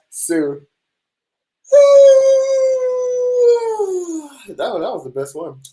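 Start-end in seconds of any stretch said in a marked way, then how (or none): 1.7-4.3 loud high-pitched laugh; long high laugh. Real, not acted. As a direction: let out a big squealing laugh. Laughs at best comment.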